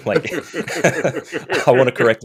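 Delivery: laughing through the words; giggling while confessing. Real, not acted. Co-host laughing as he admits grammar annoys him. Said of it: "it triggers me bad".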